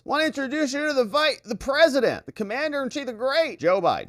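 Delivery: high, hurried Kamala voice; mock Kamala. Kamala introduces Biden, high and rushed. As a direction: rush the intro in a high put-on voice. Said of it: "she says it very quickly".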